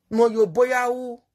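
usual speaking voice

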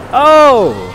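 loud high yelp; loud startled oh; startled cry. Real, not acted. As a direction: jolt at the surprise. Sudden attack in game.

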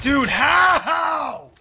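loud stunned shout; shocked shout. Real, not acted. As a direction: yell it in disbelief. Shouted disbelief.

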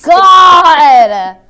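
shout. Real, not acted. Exclaims in frustration.

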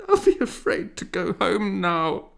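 a frightened woman dreading the dark; anxious matron afraid of the walk home; scared voice. Acted.

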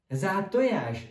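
high, loud eager answer; a boy bursting with the answer; eager blurt. Acted. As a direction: blurt it out proudly. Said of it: "zendített rá Tank".

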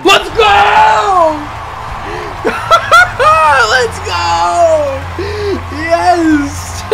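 very high, loud cheering shout; raging gamer. Real, not acted. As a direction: scream for joy at the win. Cheering Game of the Year win.

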